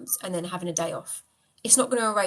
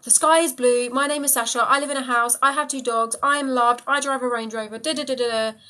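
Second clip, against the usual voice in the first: stated as plain established fact, louder and higher; with conviction; 4 semitones higher, 6 dB louder than usual. Shown as a demonstration. Showing viewers how to affirm like it's already true, with conviction. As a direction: list them off firmly, every one a certainty. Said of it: "You're just saying it like it's already a fact".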